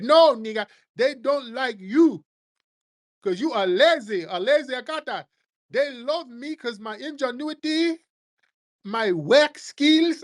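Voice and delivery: high, sneering put-on voice, slowing on the insult; mock immigrant voice. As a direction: drag out the brag smugly.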